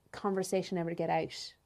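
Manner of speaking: usual speaking voice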